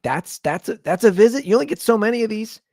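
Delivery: high, loud, incredulous "That's a visit?"; feeling cheated. Retold or imitated. Son protesting his mom's dream visit, incredulous. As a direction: scoff like you got shortchanged. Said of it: "I'm like".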